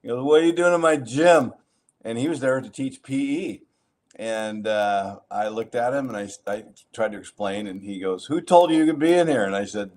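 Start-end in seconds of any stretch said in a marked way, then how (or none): 0.2-1.5 higher, louder gruff coach yelling; retold angry yell. Coach confronts him in gym. 8.3-9.4 higher, louder gruff coach yelling; retold angry yell. Coach confronts him in gym.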